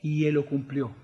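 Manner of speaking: low, soft voice with weighty pauses; hushed, solemn preaching. Real, not acted. Preacher reveals to the church who the Lamb is, solemn hush. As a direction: quietly let the revelation settle.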